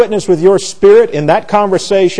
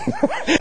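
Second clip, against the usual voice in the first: high giggle; 9 dB quieter than usual. Real, not acted. Laughing at mom's saying.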